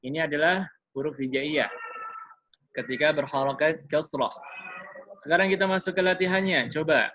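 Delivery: ordinary delivery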